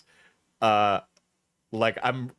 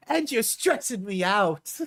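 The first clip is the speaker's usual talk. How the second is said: higher-pitched mock British accent; put-on accent; 5 semitones higher than usual. Reading breakup texts back, high put-on British accent. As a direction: act the texts out in exaggerated British. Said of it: "when I'm doing the accent".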